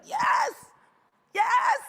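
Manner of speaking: high, thrilled squeal; celebratory high-pitched yelp, retold; acted elation. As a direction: squeal it out in hammed-up delight. Retold thrill at limo arriving.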